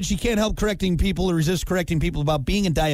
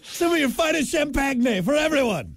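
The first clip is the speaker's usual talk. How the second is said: much higher, grand "champagne" as sham-pan-yay; hammed-up fancy host; 10 semitones higher than usual. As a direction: play a mock posh waiter. Joking fancy toast.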